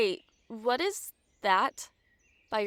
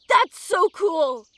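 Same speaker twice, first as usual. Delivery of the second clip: excited laugh; 9 semitones higher, 8 dB louder, slower than usual. Acted. Delighted at her horns.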